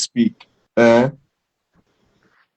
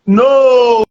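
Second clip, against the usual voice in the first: high, drawn-out "no"; long, raised-voice objection; emphatic rejection; 6 semitones higher, 4 dB louder than usual. Real, not acted. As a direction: cry out in flat disagreement. Rejecting UK as a template.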